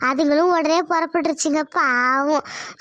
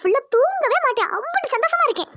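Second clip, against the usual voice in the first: gushing happy; 9 semitones higher than usual. Acted. Wife gushing about her joy.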